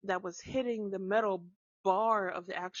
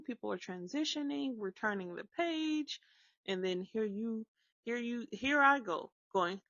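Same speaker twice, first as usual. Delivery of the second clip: ordinary talk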